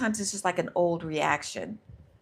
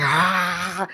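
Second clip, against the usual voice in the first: loud, drawn-out mock scream of fear; 7 dB louder than usual. Acting out what fear does when afraid, loud mock scream. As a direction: scream as panicking fear, playfully. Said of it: "fear's like".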